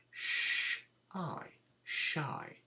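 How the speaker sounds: SH as a hush, I as "eye", then SHY whole; sound out, then blend. Shown as a demonstration. Teacher setting shy to spell, SH and I sounded then blended. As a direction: split SH and I apart, then join them into SHY. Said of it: "the sound button is sh".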